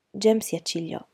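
usual speaking voice

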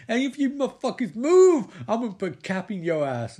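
high, loud yell breaking into laughter; laughing mock yell. Lawrence Fishburne threatening the suspects, laughing as he yells. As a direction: ham up the threat, laughing through it. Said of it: "says Lawrence Fishburne".

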